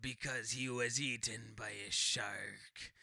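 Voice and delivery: slow, low sailor impression; put-on salty sailor, low and unhurried; Quint. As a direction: draw it out as an old seaman. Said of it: "you keep talking like that".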